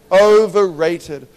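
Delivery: "Overrated" drawn out slowly, high and loud; emphatic. Real, not acted. Preacher hammers the point to the crowd, drawn out and loud. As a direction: stretch out "Overrated" and punch it.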